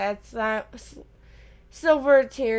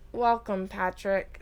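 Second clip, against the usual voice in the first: stiffly; 4 semitones lower, 6 dB quieter, slower than usual. A narrator's reading. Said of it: "said Nick stiffly".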